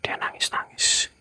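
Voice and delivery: hushed unvoiced whisper about the crying. Real, not acted. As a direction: breathe the words, barely audible. Hearing a crying ghost.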